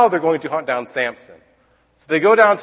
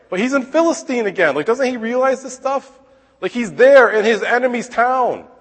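exasperated disbelief; 10 semitones higher, 3 dB louder than usual. Real, not acted. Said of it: "exasperated teacher".

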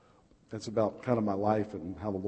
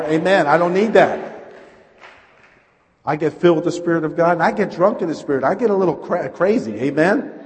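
loud, raised-pitch pulpit shout; fired-up preaching; 5 semitones higher, 10 dB louder than usual. Acted. Preacher testifying about drink and drugs, fired-up preaching. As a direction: preach it at full force with exuberant conviction.

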